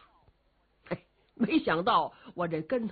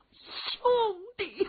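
a long sobbing wail, pitched high; wailing cry; 12 semitones higher than usual. Acted. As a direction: cry out for the lost brother. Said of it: "罗焕是放声痛哭".

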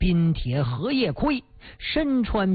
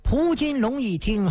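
ordinary delivery

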